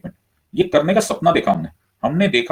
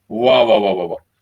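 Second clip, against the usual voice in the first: mock-admiring run of wahs, falling away; sarcastic praise; 3 semitones lower, 4 dB louder than usual. Real, not acted. Teacher mocking borrowed French slogans, sarcastic praise. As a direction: applaud it sarcastically, loud then dropping off.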